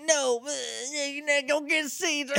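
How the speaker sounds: high cartoon Southern gibberish; garbled Southern accent. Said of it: "I don't know what accent this is".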